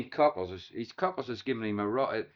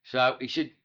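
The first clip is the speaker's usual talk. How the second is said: ordinary talk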